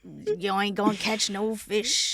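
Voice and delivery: put-on light Newfoundland accent, slow on the end; a hint of a Newfie old lady warning. Wife retelling a book, in a lightly done Newfoundland granny accent. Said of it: "do that accent when I'm talking about old ladies".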